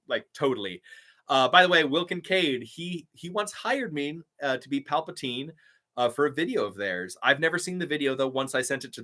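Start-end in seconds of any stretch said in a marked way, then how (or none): none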